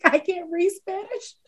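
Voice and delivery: giggly confession. Retold or imitated. Retelling being high at Whataburger to the lady, giggling softly. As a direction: let the giggles leak into "I can't read Spanish". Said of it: "I was like".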